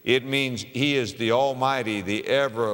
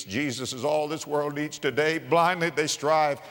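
poem recital; 5 semitones higher than usual. Acted. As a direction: chant the verse with swelling fervour.

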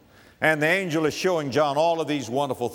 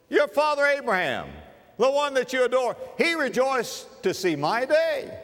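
high, emphatic voicing of Jesus; Jesus addressing Pharisees; 10 semitones higher than usual. Jesus challenging the Pharisees, high and emphatic. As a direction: press the point on them.

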